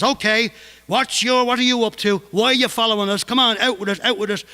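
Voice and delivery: angry demand. Retold or imitated. Brendan pinning the man against the wall, angry demand. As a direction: bark the questions at him.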